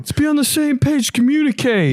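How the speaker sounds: high mock coach lecture. Friend joining in as the coach, mock high lecture. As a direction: preach teamwork like a coach.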